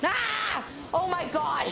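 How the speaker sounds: startled shriek into laughter; jump-scared, high yelp; scared horror game streamer. Real, not acted. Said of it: "that jump scared me".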